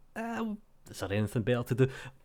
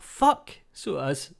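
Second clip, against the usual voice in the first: slowed, louder, stretched-out surprise; mock surprised swear; 4 semitones higher, 6 dB louder, slower than usual. Vlogger retelling finding out it's his birthday, mock surprised. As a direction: act out the moment it dawns on him.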